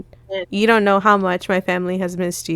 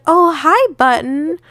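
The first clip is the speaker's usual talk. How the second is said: bright high mom voice; a mother's sing-song hello; cheery mom greeting; 6 semitones higher, 3 dB louder, slower than usual. Retold or imitated. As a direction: greet them sweetly like a mother. Mom greets visitor at home.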